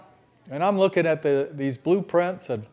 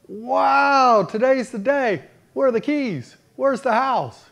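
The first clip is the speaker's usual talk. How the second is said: excited son; 7 semitones higher than usual. Retold or imitated. Son to his heavenly father, thrilled the day has come, eager and high. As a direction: burst out like a kid promised a gift.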